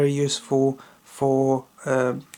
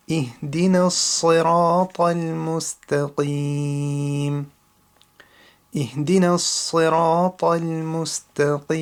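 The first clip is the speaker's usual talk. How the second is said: Quran recitation; 2 semitones higher, 3 dB louder than usual. Shown as a demonstration. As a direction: recite each verse carefully as a teacher's model. Repeats the verse for practice.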